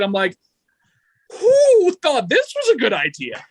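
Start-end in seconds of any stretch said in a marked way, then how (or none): 1.4-3.5 incredulous outburst. Retold or imitated. Exasperated retort about juror questions.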